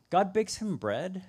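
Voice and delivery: ordinary talk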